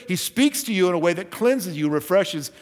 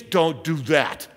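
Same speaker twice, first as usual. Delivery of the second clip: ordinary delivery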